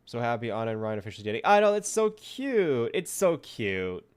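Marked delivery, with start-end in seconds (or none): 1.5-4.0 loud squeal, drawn out on cute; excited gushing. Real, not acted. Streamer reacting to the couple officially dating, loud gushing. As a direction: gush loudly, stretching the words.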